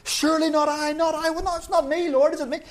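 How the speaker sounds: high, overlapping anxious protests; 1 clamouring disciples. Retold or imitated. Preacher voicing the disciples in a noisy hubbub. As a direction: pitch it up like a dozen voices at once. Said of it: "This is a whole hubbub of noise".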